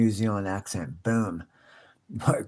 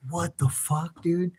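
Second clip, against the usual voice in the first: higher, stunned "what the"; pitched-up mock disbelief; shocked reaction; 8 semitones higher than usual. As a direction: react like you can't believe the damage. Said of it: "you'd be like".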